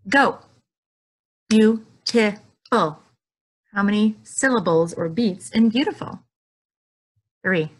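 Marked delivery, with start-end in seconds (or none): 0.1-0.4 louder race-start shout; starting call. Acted. Teacher starting the kids' race, louder. 1.5-3.0 Beautiful stretched slowly, louder; jumped syllables. Shown as a demonstration. Teacher jumping syllables with kids, slow loud beats. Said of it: "clap and jump the syllables".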